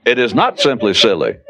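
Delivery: ordinary talk, nothing marked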